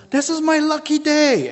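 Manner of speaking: high, loud triumphant boast; excited porter. Retold or imitated. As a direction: crow about your lucky day. Ali boasts, fist in air.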